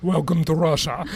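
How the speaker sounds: lightly mimicked Russian accent, amused. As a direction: do the tour guide's Russian accent as a catchphrase. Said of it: "In his Russian accent".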